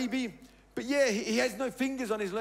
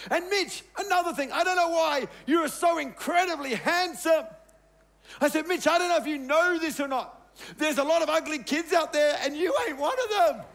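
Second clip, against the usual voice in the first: higher and louder, building to a joyful shout; excited dad; 5 semitones higher, 4 dB louder than usual. Retold or imitated. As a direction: pump your little boy up, growing bigger each line. Dad hyping up his son.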